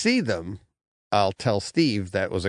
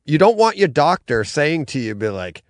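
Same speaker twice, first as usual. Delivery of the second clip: usual speaking voice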